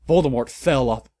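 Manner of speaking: ordinary delivery, nothing marked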